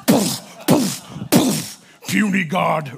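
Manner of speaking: imitating the Hulk's smash and snarl; Hulk impression. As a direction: growl it like the Hulk pounding Loki. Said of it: "where the Hulk took Loki and was like".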